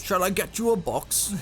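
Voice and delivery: slow, light hint of a gruff dwarf accent; a half-done dwarf accent, slowed; Gimli's accent. Joking co-caster quotes a dwarf, light dwarf accent. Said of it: "I said that in Gimli's accent".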